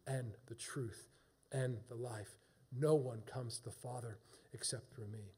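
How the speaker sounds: hushed, low reading of scripture; solemn preaching. Real, not acted. Preacher quoting Jesus to the congregation, solemn and quiet.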